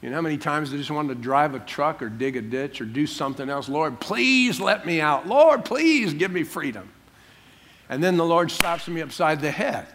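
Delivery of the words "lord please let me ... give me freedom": slow, higher-pitched acted begging; pleading. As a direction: stretch out PLEASE in a mock desperate plea. Retells begging God for release.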